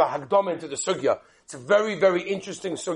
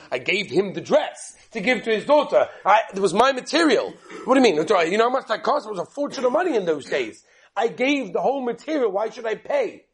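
raised, pushy objection; acted indignation with pitched-up emphasis; indignant protest; 3 semitones higher than usual. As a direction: argue back like an affronted wife. Wife protests being asked to pay.

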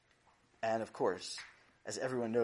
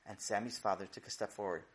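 plain reading voice

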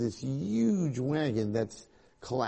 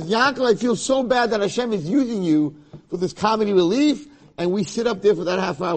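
taunting Satan; 7 semitones higher, 6 dB louder than usual. Acted. Satan taunting Yaakov about comedy relief.

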